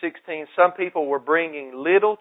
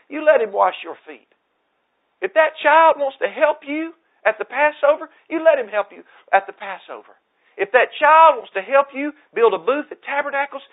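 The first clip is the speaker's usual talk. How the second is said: higher and louder, pressing every line; full-force preaching; 10 semitones higher, 8 dB louder than usual. Acted. Pastor driving home Yeshua's words, fervent preaching. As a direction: preach it hard to the congregation.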